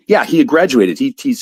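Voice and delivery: ordinary delivery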